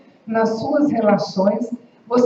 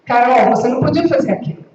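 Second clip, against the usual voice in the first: sharp, louder and fast scolding; fast, louder reproach; aggressive tone; 4 dB louder, much faster than usual. Shown as a demonstration. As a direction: snap it at her like an accusation. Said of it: "eu posso estar agredindo".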